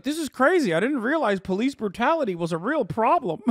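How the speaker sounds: high mocking whiny voice; high, mocking fake surprise; mocking the agent. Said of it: "A T F agent's like".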